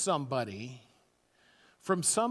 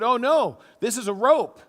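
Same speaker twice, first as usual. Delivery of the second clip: a touch of surprise, louder and higher; mock surprise; 5 semitones higher, 9 dB louder than usual. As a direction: exclaim it as a discovery. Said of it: "and he said".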